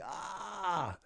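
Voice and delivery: a high sharp cry of pain; pained yelp. Retold or imitated. Siegel stepping on urchins, high pained cry.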